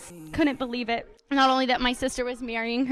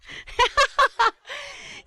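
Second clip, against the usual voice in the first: laughing; 14 semitones higher, 4 dB louder than usual. Real, not acted. Laughs at own joke.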